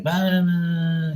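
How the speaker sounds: high, drawn-out mock babble; loud chattering woman. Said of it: "she was so loud".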